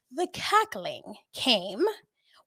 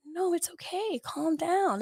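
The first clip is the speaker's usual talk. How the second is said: calm reassurance; 7 semitones higher, slower than usual. Retold or imitated. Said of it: "I was so calm".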